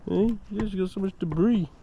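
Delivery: high playful voice for a dog; dog-talk voice. Real, not acted. Talking to the dog.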